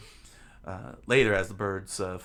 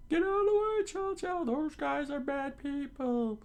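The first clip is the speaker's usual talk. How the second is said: pleading townspeople. Acted. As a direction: urge the dog aside in a squeal.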